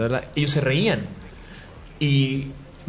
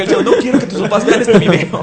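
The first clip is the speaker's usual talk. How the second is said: laughing; 8 semitones higher, 8 dB louder than usual. Retold or imitated. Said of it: "Y dije".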